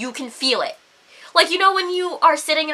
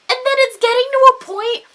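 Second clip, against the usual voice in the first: loud, high-pitched indignation; exasperated rant; 9 semitones higher, 6 dB louder than usual. Real, not acted. Woman ranting about guys ignoring excuses, exasperated. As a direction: build up in outrage.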